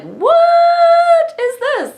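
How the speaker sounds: high, slow, loud shocked yell; a stretched-out stunned outcry; baffled shout. Retold or imitated. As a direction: shout it in total disbelief. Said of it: "I was kinda like".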